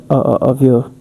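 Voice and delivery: ordinary talk, nothing marked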